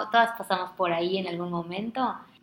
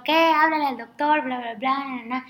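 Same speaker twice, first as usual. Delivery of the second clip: high, urgent, loud call to act; alarmed husband; 5 semitones higher, 4 dB louder than usual. Retold or imitated. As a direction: sound startled, pushing to call the doctor. (esposo alarmado pide llamar al doctor, tono agudo y urgente)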